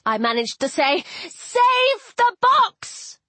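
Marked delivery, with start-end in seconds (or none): 1.3-3.2 high, drawn-out urgent cry; urgent call. A narrator's reading. Heroine calling friends to battle, urgent high cry. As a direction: call it out high and slow, as an order.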